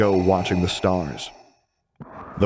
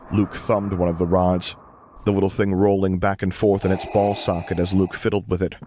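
plain reading voice

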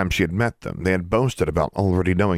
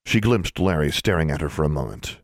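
plain reading voice

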